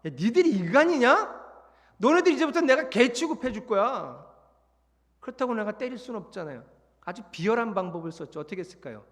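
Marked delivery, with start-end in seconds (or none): 0.0-4.2 higher, louder furious rant; angry scolding. Retold or imitated. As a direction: tear into the troops. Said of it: "걔네들 앞에 서서 이렇게 얘기했어요".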